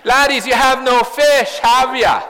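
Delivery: cheeky teasing call from the shore; cheeky laddies. Acted. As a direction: tease the young fishermen like a fond friend. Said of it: "captures the cheekiness".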